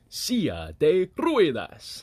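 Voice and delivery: slow, deliberate Spanish accent with rolled R. Shown as a demonstration. Showing friend how to say wheelchair in Spanish, slow rolled-R Spanish accent. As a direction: draw out the Spanish, rolling the R. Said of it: "like to do the R, rolling the R".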